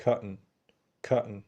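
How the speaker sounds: "cotton" as "COT-n" with a glottal stop; "cotton" without a released t; swallowed t. Shown as a demonstration. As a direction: catch the t of "cotton" in the throat, then n. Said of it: "بنقول".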